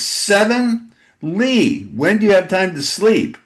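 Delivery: high, amazed question; surprised. Real, not acted. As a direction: sound blown away. Host amazed at seven books.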